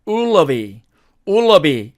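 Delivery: "ullery" as blurred "u-lo-bi"; reduced will it be. Shown as a demonstration. Teacher reducing will it be for learners, reduced. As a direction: run "ullery" together, first word nearly gone. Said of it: "이렇게 발음을 합니다".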